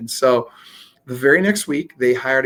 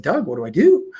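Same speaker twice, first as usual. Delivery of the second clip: higher, louder mock panic; retold freaking out; 4 semitones higher, 5 dB louder than usual. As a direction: plead for advice in retold panic. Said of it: "So now I'm freaking out".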